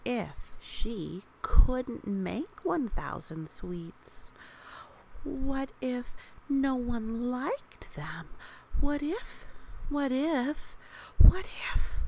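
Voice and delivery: slow worried questions rising to a loud high cry; building panic. Acted. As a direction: let the worry spiral up into panic. Worried character thoughts, mounting.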